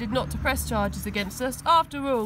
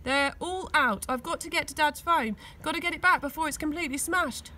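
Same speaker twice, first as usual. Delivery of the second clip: plain reading voice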